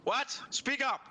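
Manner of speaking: calling out high and loud across the garden. Acted. The party member turns and calls out to Elara, loud. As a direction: shout back over your shoulder. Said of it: "you turn around going".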